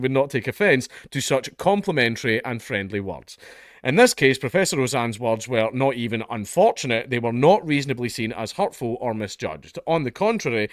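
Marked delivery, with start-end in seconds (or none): none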